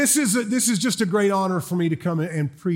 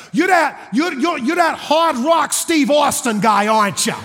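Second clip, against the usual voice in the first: put-on New York-area accent; 6 semitones higher, 4 dB louder than usual. Seatmate guessing at a wrestling star, excited New York-area accent. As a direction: insist on it in a thick Jersey accent. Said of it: "he is from Jersey or the Bronx or Brooklyn".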